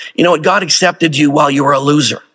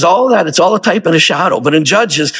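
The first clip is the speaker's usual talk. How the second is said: ordinary talk, nothing marked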